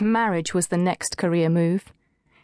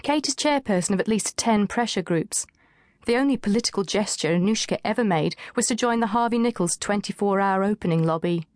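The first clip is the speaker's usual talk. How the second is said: plain reading voice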